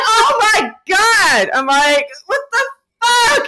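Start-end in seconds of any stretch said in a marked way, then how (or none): 0.1-1.5 high, drawn-out amazed cry; loud starstruck re-enactment; retold shock. 2.3-3.5 very high, incredulous squeal; high-pitched starstruck astonishment; retold disbelief. Re-enacts disbelief at the star.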